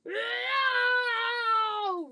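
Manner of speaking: long, high, loud wail; roar of pain. Acted. Sleeping giant hit by a nightmare, roaring in pain. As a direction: roar it out as one drawn-out cry. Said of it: "roared the flesh lump eater".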